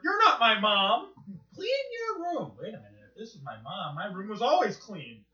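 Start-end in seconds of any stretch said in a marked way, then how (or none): 0.0-2.5 mock mom voice. Quoting Space Ghost mom scene.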